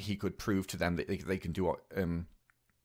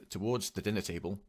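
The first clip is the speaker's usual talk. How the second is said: normal talking voice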